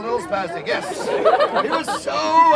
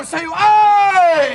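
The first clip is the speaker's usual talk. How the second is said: shout; 6 semitones higher, 7 dB louder than usual. Acted. Calling audience to shout.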